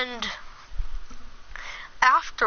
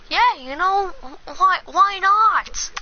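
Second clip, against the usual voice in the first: eager mock shrug; 7 semitones higher than usual. Casual yes to asking her out.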